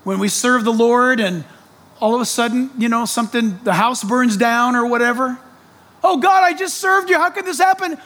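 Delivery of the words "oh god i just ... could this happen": high, whining plea to God; whiny complaint. Pretend. Preacher mimicking a complaining believer, high and whiny.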